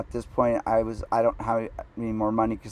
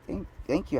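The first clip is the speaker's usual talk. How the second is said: higher, softer, mock-touched thanks; meek gratitude; 5 semitones higher, 8 dB quieter than usual. Man thanking date for faint praise, meekly grateful. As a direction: stammer out tiny, overgrateful thanks.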